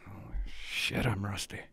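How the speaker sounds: quiet breathy whisper to himself; a hushed oath under the breath; whispered aside. Real, not acted. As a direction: whisper it to yourself. Muttered aside about being rusty.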